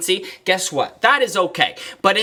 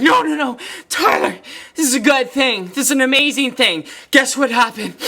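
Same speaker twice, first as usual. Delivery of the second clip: excited client; 5 semitones higher than usual. Retold or imitated. Retelling the crying client's joyful news, excited. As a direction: gush it like happy news through tears.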